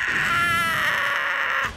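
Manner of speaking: high loud scream; shouted scream. Real, not acted. Gamer getting knocked off the map, loud scream. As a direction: scream as you get knocked off. Dying in game.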